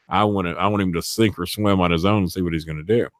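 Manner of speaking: ordinary delivery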